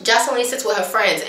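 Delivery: normal talking voice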